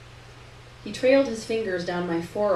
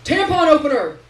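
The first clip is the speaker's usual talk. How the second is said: Terrence voice; 7 semitones higher, 9 dB louder than usual. A narrator's reading. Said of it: "Terrence says".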